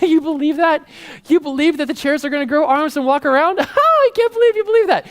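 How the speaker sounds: high-pitched scoffing, breaking into a big laugh; incredulous laughing mockery. Laughing at conspiracy theorists out there, incredulous mockery. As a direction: ridicule it in rising, laughing disbelief. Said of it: "We start laughing. We're like".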